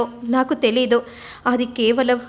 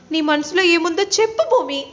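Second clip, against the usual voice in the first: higher, louder desperate cry; pleading shout; 5 semitones higher, 4 dB louder than usual. Acted. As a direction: call out to her as she's taken away. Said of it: "గట్టిగా అరుస్తూ ఉంటే".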